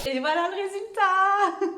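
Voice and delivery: high, loud, then laughing through tears; emotional laugh of joy; excited reveal. Real, not acted. Reveals final result, laughs tearfully. (rire ému)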